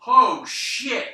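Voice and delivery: loud, high, drawn-out astonishment; re-enacted shock. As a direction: blurt it out big, reliving the shock. Re-enacting own shock at match.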